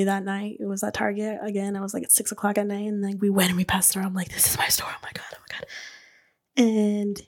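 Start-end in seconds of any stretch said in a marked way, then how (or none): 4.3-5.7 hushed, breathy excitement; awed whisper. Retold or imitated. New owner passing her store at night, awed whisper. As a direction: whisper it as if barely believing it.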